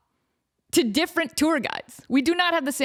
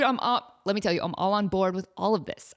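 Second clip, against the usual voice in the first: ordinary delivery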